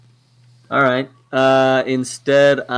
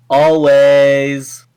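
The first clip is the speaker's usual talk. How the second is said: long, loud, higher holler; yelling back; 4 semitones higher, 17 dB louder than usual. Acted. Player answering Ada's shout as a free action, yelled. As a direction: yell it back, drawing the word out. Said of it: "Yelled back".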